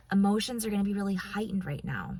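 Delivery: normal talking voice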